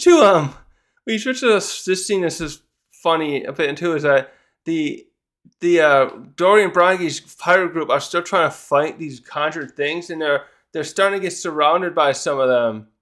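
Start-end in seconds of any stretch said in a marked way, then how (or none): none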